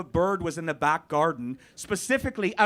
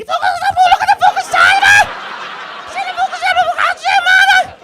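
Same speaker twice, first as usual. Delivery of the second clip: screeching Scouse shout, extremely high; frantic, piercing Scouse yell at full volume; level-three Scouse; 12 dB louder than usual. Shown as a demonstration. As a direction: shriek Scouse like a squeak toy. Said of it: "You're in level three".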